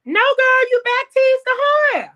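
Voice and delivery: high, loud, laughing cry; excited shout. Real, not acted. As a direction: blurt it out sky-high with glee. Excited answer to friend's question.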